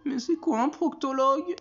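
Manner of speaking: very high-pitched, incredulous question; alarmed bafflement. Acted. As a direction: demand an explanation in a high voice. Baffled husband demands an explanation.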